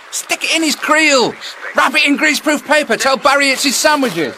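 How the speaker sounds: bursting with prank ideas; shouting gleeful prank advice at the telly anglers; excited. Real, not acted.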